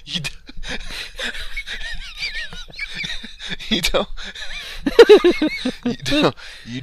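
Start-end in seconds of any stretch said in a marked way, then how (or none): 0.3-3.7 long high-pitched laughing fit; helpless laughter. Real, not acted. Co-host cracking up at the donkey story, laughing helplessly. 4.1-5.8 high, loud burst of laughter; loud laughing fit. Real, not acted. Co-host unable to finish his sentence, laughing loudly. Helpless laughter at donkey story.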